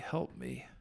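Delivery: a soft unvoiced plea; whispered help me. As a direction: breathe the words like a prayer. Retold plea in prayer.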